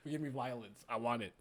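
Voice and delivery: ordinary talk, nothing marked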